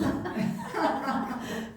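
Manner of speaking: laughter. Real, not acted. Laughing at family rejecting cooking.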